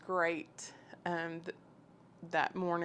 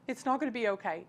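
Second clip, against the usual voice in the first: higher retold protest from a distraught friend; imitating an upset friend's objection; 4 semitones higher, 3 dB louder, faster than usual. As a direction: push back against comfort, voice raised in pitch.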